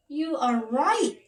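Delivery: the king, a touch awed; impressed king. Acted.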